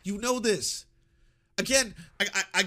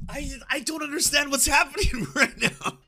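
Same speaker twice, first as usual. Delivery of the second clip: laughing disbelief; 6 semitones higher than usual. Real, not acted.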